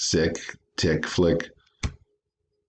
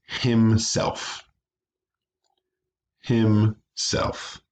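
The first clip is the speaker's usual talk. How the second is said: "himself" as two separate beats, him, self; broken by syllable; 4 semitones higher, much slower than usual. Shown as a demonstration. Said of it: "break them by syllable".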